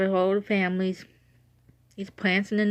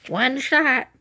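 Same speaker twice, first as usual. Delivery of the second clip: imitating Grandma; 5 semitones higher than usual. Granddaughter mimicking Grandma about the dog, pitched up. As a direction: do a lightly voiced, matter-of-fact grandma. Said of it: "Now you sound like Grandma".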